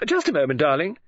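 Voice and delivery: eager gossip, reacting to an unheard caller; gossipy lady novelist. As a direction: lively pitch swoops, curious and confiding. Missus Oliver covers for the interruption.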